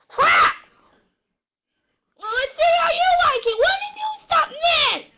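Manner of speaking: high, loud angry yelling; furious shout. Acted. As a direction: scream it at your rival.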